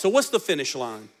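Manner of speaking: usual speaking voice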